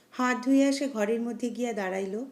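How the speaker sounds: ordinary delivery, nothing marked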